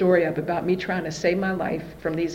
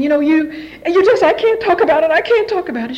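high, loud mock distress; fake crying; 8 semitones higher, 6 dB louder than usual. Daughter to mother while improvising a story, high fake-tearful voice. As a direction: plead it in a put-on tearful voice. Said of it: "I made myself cry".

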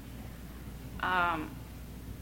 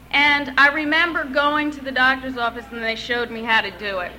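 normal talking voice

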